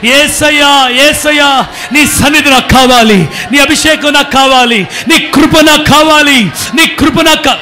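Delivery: loud pleading prayer cry; loud fervent begging prayer; shouted prayer. Shown as a demonstration. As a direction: pour out the plea at full voice. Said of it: "ఒక్కసారి గట్టిగా".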